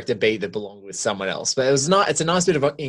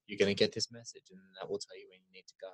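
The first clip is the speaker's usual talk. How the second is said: low, very soft retold movie line; deliberate, imitated; 5 semitones lower, 14 dB quieter, much faster than usual. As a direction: imitate him, hushed and low, fading away. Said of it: "talks really slowly and deliberately".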